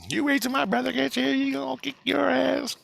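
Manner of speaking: put-on very high, squeaky threatening kid; mock yelling kid. Storyteller mimics rival kid threatening them, high mock yell. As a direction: imitate a little kid's squealed threat. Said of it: "he's yelling at".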